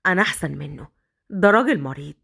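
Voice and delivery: low, contemptuous; a hint of sneer; scornful rival. Yassin belittles Badr. (نبرة احتقار منخفضة)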